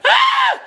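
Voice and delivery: piercing high battle yell; fight scream. Shown as a demonstration. Streamer showing how he screams in fights, high shriek. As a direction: let out a shrill fight shriek. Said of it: "I scream like".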